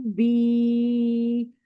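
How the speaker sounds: बी drawn out for two seconds; long held i. Shown as a demonstration. Teacher holds बी long again for the students. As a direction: sustain बी steady and long. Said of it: "पूरे दो second तक".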